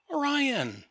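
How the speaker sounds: high dramatic name call; announcing Orion. Retold or imitated. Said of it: "Orion from the New Gods appears".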